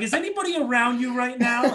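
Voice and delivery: high, amused host asking; mock incredulous host. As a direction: tease the caller. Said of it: "He's like".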